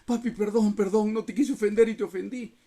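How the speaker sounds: father pleading with his son for forgiveness; pleading apology. Retold or imitated. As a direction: beg your son's forgiveness, contrite. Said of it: "decía".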